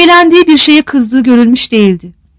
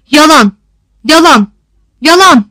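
three separate raised cries with pauses between; shouted denial; 2 semitones higher, 4 dB louder than usual. A narrator's reading. Lena breaks the frozen silence of the crowd, a raised shout. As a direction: cry it out, each word on its own. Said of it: "diye bağırdı".

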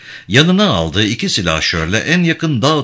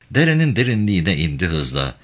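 usual speaking voice

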